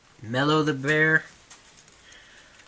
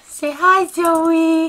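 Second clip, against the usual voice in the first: high, loud sing-song pet talk; 14 semitones higher, 6 dB louder, much slower than usual. Real, not acted. Owner greeting her dog, high pet voice.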